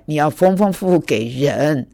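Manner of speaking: normal talking voice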